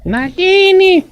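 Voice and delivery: very high, loud, drawn-out Nagini; high squeal. Pretend. Echoing the snake's name, high squealed call. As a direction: squeal Nagini in a held falsetto.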